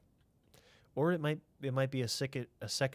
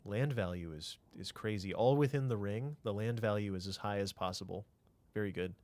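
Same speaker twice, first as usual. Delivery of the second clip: usual speaking voice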